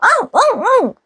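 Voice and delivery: put-on squeaky eager little kid; child's high excited yes. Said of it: "무조건 나간다 그래요".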